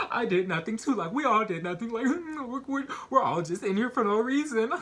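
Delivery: high-pitched, laughing through the words; mocking cellmates. Cellmates scoffing at claims of innocence, mocking laughter. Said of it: "the other cellmates were like".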